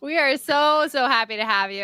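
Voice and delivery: ordinary talk, nothing marked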